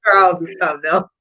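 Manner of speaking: amused, speaking through laughter; laughing. Real, not acted. Laughing at Charles's joke.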